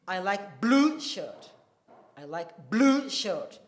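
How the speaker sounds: blue loudest, said slowly; stress on blue, slow. Shown as a demonstration. Teacher recapping the rule, stress on blue. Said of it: "blue is the emphatically stressed word".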